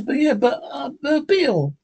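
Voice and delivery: high, stammering sidekick protest, slowing at the end; Jingles' voice. Acted. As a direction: sputter at Bill.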